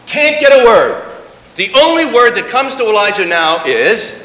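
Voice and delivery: ordinary talk, nothing marked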